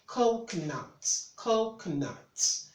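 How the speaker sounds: slow "coconuts" with a long, stressed COH; stress on first syllable. Shown as a demonstration. Tutor repeating after marking the stress, slow stressed pronunciation. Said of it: "That's where you have the stress there".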